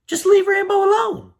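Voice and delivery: high, loud cry of frustration; exasperated outburst. Real, not acted. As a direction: plead for Rambo at the top of your voice. Exasperated outburst defending Rambo.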